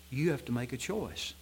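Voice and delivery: ordinary talk, nothing marked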